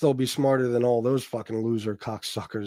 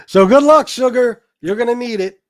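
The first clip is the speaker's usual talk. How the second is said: taunting; 9 semitones higher, 8 dB louder than usual. Acted. Taunting rival on stream.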